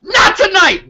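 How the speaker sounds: high, loud punch on Not tonight; a loud defiant call of Not tonight; emphatic shout. Real, not acted. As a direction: slam the words down hard. Emphatic declaration.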